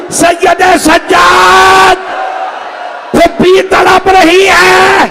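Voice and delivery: very high, loud, drawn-out cry; anguished calling out. Retold or imitated. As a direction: stretch the name into a long wailing summons. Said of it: "مولا نے آواز دی".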